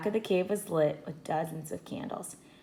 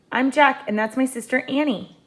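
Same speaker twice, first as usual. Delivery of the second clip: Jack answering; 7 semitones higher, 5 dB louder than usual. A narrator's reading. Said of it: "Jack answered".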